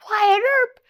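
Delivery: high, loud retold exclamation; re-enacted shock, voice leaping up; astonished cry. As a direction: call the name out in acted disbelief. Retold astonished cry on seeing him.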